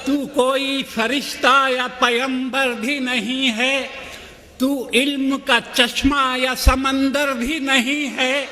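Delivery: measured, rhythmic verse delivery; poetry recitation. Acted. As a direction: declaim each line with steady cadence. Poet recites verses at a mushaira.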